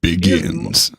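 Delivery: deep, slow epic trailer narrator; movie trailer voice. Pretend. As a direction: read it like an old film trailer. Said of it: "If you don't do that voice".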